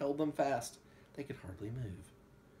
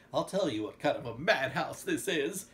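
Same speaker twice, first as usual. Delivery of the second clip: loud, raised-pitch booming announcement; roaring; 4 semitones higher, 6 dB louder than usual. A narrator's reading. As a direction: roar it over a crowd. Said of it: "Christopher Joel roared".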